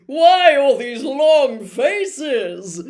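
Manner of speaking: drawn-out, sing-song jollity; beaming teacher. A narrator's reading. Lockhart teasing the gloomy class, loud and drawn out. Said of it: "beaming around him".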